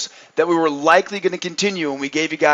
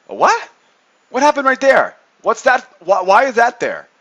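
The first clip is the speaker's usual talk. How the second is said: mock surprise; 6 semitones higher, 4 dB louder than usual. Feigned surprise at chart.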